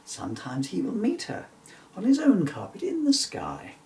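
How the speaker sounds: ordinary delivery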